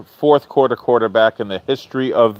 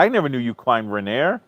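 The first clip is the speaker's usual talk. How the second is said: ordinary talk, nothing marked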